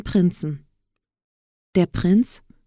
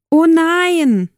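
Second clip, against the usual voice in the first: high, loud, drawn-out lament; dismayed cry; 10 semitones higher, 6 dB louder than usual. A narrator's reading. Aschenputtel seeing lentils in the ashes, crying out. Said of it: "rief Aschenputtel".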